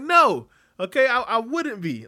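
loud, high mock protest; emphatic retort. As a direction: push back hard, voice jumping up. Acts out his objection to doubters.

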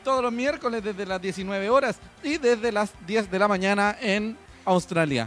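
long stretched show-host welcome; announcer voice. Acted. Host restarts the show intro.

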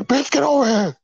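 high-pitched, playful holler; mock-excited squeal of a greeting. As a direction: pretend to call a friend over, voice shooting way up. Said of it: "I'll probably be like".

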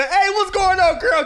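high, bright mock-friendly hello; mock friendly greeting. Guy greeting a girl he likes, mock friendly. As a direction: put on a fake-casual friendly voice.

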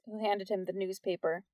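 usual speaking voice

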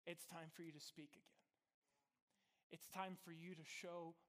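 soft, tender, almost whispered; gentle God voice. Acted. God telling Peter it's time, soft and tender.

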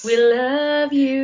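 high, slow, adoring fan voice; gushing fan. Acted. Echoing the fan shoutout, high, slow and swooning. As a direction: swoon it out slowly.

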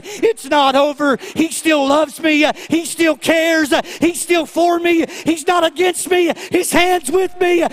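loud, climbing preacher's cry; full-force preaching. Acted. Preacher to the congregation, loud and rising. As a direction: preach it up to the rafters.

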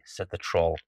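ordinary delivery, nothing marked

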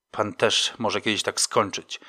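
usual speaking voice

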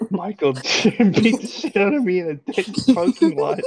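high, loud tattling through laughter; laughing impression. Friend as Dwight tattling on Jim, breaking into laughter. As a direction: struggle to stay in character while cracking up.

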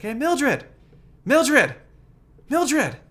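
high, loud call of her name; calling across the street. Retold or imitated. Man calling to a woman across the street, high loud call.

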